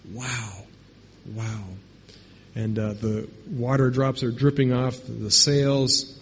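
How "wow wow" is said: hushed, stunned wow; awed whisper. Acted. Disciples stunned by sudden calm, awed hush. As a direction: breathe it out in awe.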